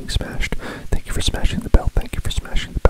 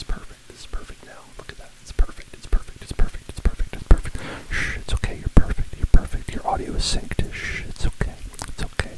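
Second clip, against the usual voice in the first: hushed, soothing whisper. Acted. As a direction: comfort it like a frightened child. Soothing the fixed video file.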